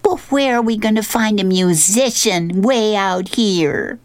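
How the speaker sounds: high, put-on girlfriend voice; high-pitched character question; Honey. A narrator's reading. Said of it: "Honey turns to him and says".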